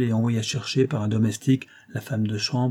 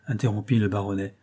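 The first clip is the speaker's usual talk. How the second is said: plain reading voice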